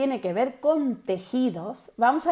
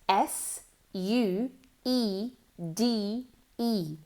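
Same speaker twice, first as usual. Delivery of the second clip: slow spelling; 5 dB quieter, much slower than usual. Shown as a demonstration. Teacher slowly spelling suede for learners. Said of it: "Now, suede is".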